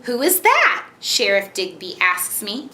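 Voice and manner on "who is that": high-pitched, emphatic storybook question; expressive character question. Shown as a demonstration. Mother repeats sheriff's question for child to match, high and emphatic. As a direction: lift the whole question high. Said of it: "We're gonna do that part again".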